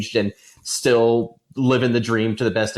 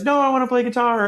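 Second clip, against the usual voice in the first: put-on whiny kid voice; 14 semitones higher than usual. Kid whining back at dad.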